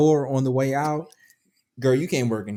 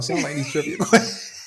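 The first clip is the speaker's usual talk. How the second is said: laughing. Real, not acted. Teasing co-host about trivia nerves.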